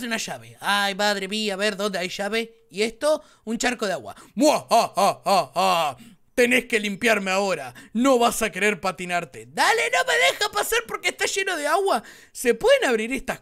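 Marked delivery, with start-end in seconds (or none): 4.3-9.5 mustache twirling villain. Acted. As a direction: cackle like a cartoon bad guy. Talking puddle taunts the player.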